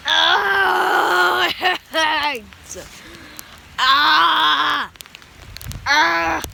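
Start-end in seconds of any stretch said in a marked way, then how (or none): 0.1-1.5 pained cry. Real, not acted. Pelted by hail, crying out. 3.8-4.9 pained shout. Real, not acted. Pelted by hail, crying out.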